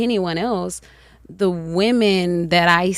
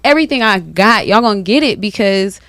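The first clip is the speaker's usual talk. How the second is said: loud, high Southern flourish; Southern colloquial; 6 semitones higher, 6 dB louder than usual. Retold or imitated. Woman to friends, owning her Southern accent loudly. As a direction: lean into the Southern talk, proudly.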